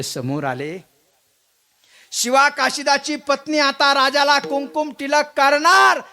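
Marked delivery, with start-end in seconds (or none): 2.1-6.0 high, loud kirtan narration rising into a called-out command; full-force dramatic storytelling. Acted. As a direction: ring out the climax, then call from inside the house. (उंच, जोरदार नाट्यमय कथाकथन)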